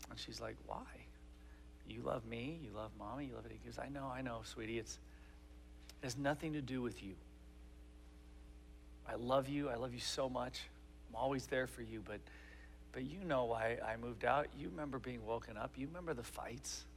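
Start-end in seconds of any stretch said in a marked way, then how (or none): 0.7-3.5 softly voiced little girl's plea; small, soft, questioning protest; pleading child. Little girl pleading with her dad. 3.8-16.8 soft, tender father explaining gently; hushed, soothing fatherly reassurance; reassuring dad. Acted. Dad reassuring his upset daughter.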